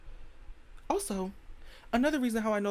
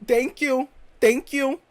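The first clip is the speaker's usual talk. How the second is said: loud, higher-pitched thank you; emphatic thanks; 5 semitones higher, 9 dB louder, slower than usual. Real, not acted. Comic praising an American rapper, emphatic thanks. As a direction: proclaim your thanks loudly.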